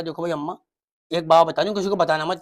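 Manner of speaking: low, hushed secret-sharing; mock confiding. Daughter telling mother a secret, low and confiding.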